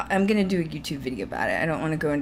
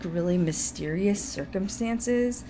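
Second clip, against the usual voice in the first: ordinary delivery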